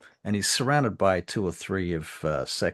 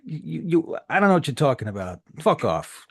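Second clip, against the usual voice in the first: loud, fast stammering denial; flustered anger; 7 semitones higher, 8 dB louder than usual. Acted. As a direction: sputter and brush him off.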